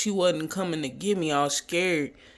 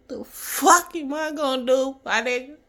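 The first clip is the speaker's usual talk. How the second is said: panicked inner voice; 6 semitones higher than usual. Retold or imitated. Panicked inner voice, mom unreachable.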